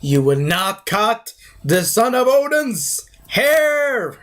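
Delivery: slow, high, drawn-out heroic proclamation; mock-imperious warrior, words held long; Thor. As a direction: declaim it grandly, stretching the last word. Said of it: "And Thor was like".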